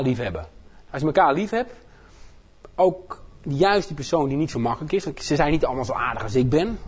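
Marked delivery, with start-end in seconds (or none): none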